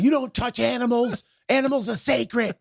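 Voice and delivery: high, hammed-up righteous protest; self-righteous outrage. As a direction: cry out as a mock animal crusader. Acts himself defending animals.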